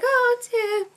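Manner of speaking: very high-pitched sympathetic coo; gushing coo. Retold or imitated. Reacting to Tim getting emotional, high gushing coo. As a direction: melt over him in a high little voice.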